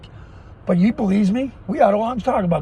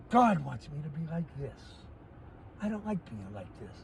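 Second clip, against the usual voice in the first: much lower and softer, calm; talking calm; 6 semitones lower, 14 dB quieter than usual. Real, not acted. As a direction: drop right down, quiet and calm. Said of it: "I don't like talking calm".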